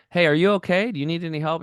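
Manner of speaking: shouting over to check on someone; calling out. Retold or imitated. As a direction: call across to a stranger on the roadside. Calling out to stranded driver.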